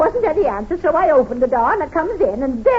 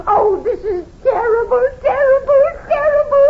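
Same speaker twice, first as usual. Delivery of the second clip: crying; 9 semitones higher, 4 dB louder than usual. Acted. Landlady finds a dead lodger.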